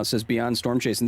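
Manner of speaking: ordinary talk, nothing marked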